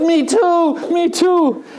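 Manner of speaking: high, eager agreement; eager me too. Retold or imitated. Preacher telling a joke, eagerly agreeing with the jumper. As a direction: chime in eagerly, delighted.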